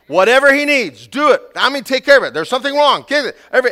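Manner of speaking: loud, high, urgent orders; frantic parents. Acted. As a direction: scramble in a mock panic. Frantic parents scrambling to soothe baby.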